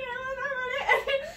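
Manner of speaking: high, laughing-crying protest; half laugh, half cry, brushing him off; teary laughing. Retold or imitated. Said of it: "starting to cry".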